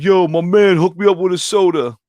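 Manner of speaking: high, loud mock street kid; hammed-up young wannabe gangster asking for a soda; wannabe thug kid. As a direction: ape a kid acting tough. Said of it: "And he was like".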